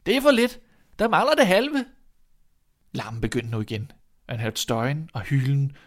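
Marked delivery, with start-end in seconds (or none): 0.1-1.9 loud, high-pitched holler; shout. A narrator's reading. Boy complaining up the chimney that half is missing, shouting. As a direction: call up loudly, pitch leaping high. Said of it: "råbte han".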